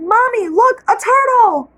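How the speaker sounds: put-on child spotting a turtle, delighted; excited child. A narrator's reading. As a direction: point it out like a delighted kid. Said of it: "Rose looked down ... and said".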